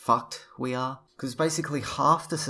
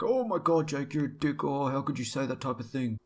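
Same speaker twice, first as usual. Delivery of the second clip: mock offended; 4 semitones higher than usual. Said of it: "many get way too offended".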